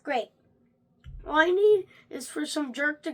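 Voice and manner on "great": usual speaking voice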